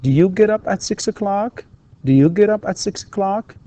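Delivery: "Do you get up at six o'clock?" with the pitch climbing up to a high end; rising intonation. Shown as a demonstration. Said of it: "ارتفاع في نبرة الصوت".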